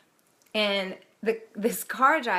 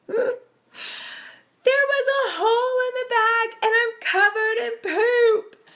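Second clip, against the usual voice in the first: much higher, louder, half-crying mock wail; 14 semitones higher, 5 dB louder than usual. Son tells mom he's covered in poop, high mock wail. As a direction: whine it like a kid in disgusted despair. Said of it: "he turns around and he goes".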